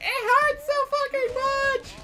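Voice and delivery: hammed-up howl of pain; squealing in pain. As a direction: shriek it like the arm is breaking. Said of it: "it's like".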